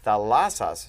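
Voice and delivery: Thalassas loud, LAS stressed; second-to-last stress. Shown as a demonstration. Teacher showing where the accent falls, middle stress on Thalassas. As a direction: hit the middle syllable of Thalassas. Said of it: "boom, the accent's here".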